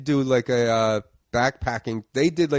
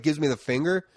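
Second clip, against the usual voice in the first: ordinary talk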